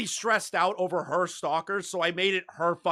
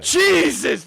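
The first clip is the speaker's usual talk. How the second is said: shocked exclamation; 10 semitones higher, 6 dB louder than usual. Real, not acted. Shocked reaction to the clip.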